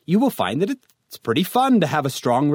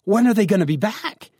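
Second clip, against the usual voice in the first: put-on exasperated teen; 8 semitones higher, 3 dB louder than usual. Acted quarrel with dad.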